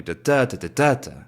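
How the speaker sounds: da's rising and falling in a rolling beat; rolling rhythm. Shown as a demonstration. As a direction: roll the da's like peaks and valleys. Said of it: "Peaks and valleys".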